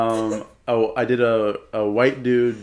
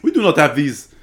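loud, higher-pitched mock Kenyan accent; 7 semitones higher, 9 dB louder than usual. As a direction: put on a big Kenyan accent. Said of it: "like, a Kenyan sort of accent".

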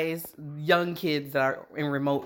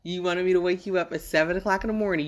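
usual speaking voice

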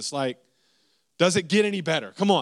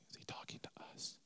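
whispered aside, unvoiced and hushed; whispering to his wife during the sermon; 12 dB quieter than usual. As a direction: whisper it to your wife without turning your head. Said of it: "we look at the corner of our eye like".

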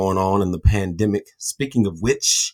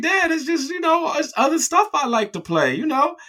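put-on very high whiny kid voice; imitating young self. Young son pleading with dad for games, high whiny. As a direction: plead like a little boy.

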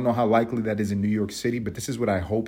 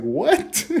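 incredulous what; 8 semitones higher, 4 dB louder than usual. Retold or imitated. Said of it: "I was like".

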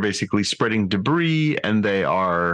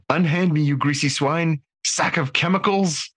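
hammy stage actor; 8 semitones higher than usual. Retold or imitated. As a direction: ham it up like a theatrical villain. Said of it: "from Agamus".

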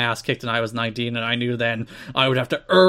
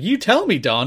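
quoting Mike Tenay; 6 semitones higher than usual. Retold or imitated. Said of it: "It's like".

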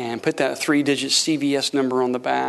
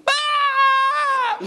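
piercing high comic shriek; hammed-up shriek imitating a startled wife; wife's mock scream; 4 dB louder than usual. Wife sees the money on the table, mock high scream. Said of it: "She went".

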